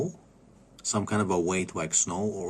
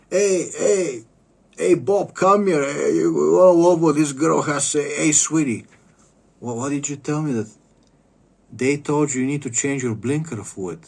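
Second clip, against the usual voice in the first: much higher and louder, shouting to a coworker; calling out amused; 5 semitones higher, 7 dB louder than usual. Retold or imitated. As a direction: holler across the shop, barely holding in laughter. Clerk calling coworker over, laughing.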